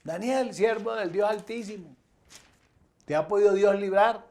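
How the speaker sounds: high-pitched call, acted as the king; king's anxious question. Worried king calling to Daniel in the lions' pit, anxious question. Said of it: "cuando llega le hace la pregunta".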